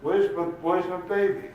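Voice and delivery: high, loud frantic cry; acted panicked shout; screaming grandmother. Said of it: "And she's screaming".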